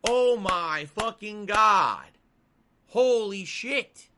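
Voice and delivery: slow, loud stunned exclamation; amazed. Real, not acted. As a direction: drag it out in loud disbelief. Reacting to game value.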